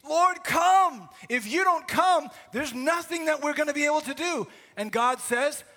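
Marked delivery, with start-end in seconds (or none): 0.1-4.5 shouted, much higher and louder plea; desperate cry. Acted. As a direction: cry out to God as if all is lost. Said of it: "They were asking".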